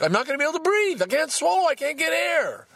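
very high, squeezed panicky voice; acted panic. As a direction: pitch shoots way up as if air is running out. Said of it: "there's the panic".

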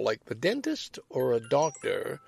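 ordinary delivery

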